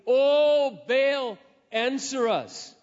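a loud cry to Baal; crying out to a silent god; mock shout. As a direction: call out like a desperate crowd.

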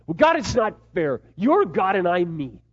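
high, loud, aggrieved objection; protesting to God. Retold or imitated. Angry husband arguing back at God, protesting.

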